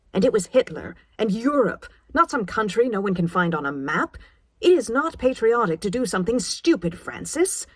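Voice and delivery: mother berating her daughter; scolding. A narrator's reading. Mother's tearful rebuke.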